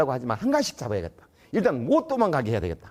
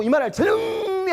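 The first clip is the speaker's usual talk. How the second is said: exclaiming; 14 semitones higher, slower than usual. Real, not acted. Exasperated exclamation.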